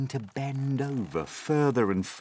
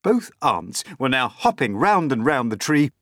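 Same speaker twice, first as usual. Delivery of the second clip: usual speaking voice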